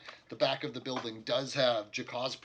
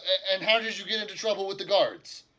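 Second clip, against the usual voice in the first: higher, louder exasperated question; innkeeper voice; 8 semitones higher, 9 dB louder than usual. Acted. Innkeeper grilling the party about the guards, loud and higher. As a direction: demand to know what happened. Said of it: "He's like".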